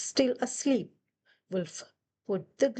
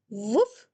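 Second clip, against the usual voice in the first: dog woof; 4 dB louder than usual. Acted. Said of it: "said the dog".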